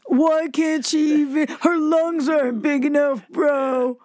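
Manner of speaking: lightly voiced stoner bro; a hint of a stoned dude complaining. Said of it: "I love your stoner voice".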